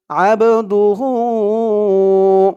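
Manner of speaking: slow chant with a long drawn "হু"; one-alif elongation. Shown as a demonstration. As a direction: stretch the final vowel of "আব দু হু". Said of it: "এখানেও এক আলিফ টান হবে".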